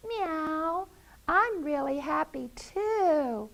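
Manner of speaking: high mewing cat voice; happy cat. Acted. Little cat arriving happily at dinner.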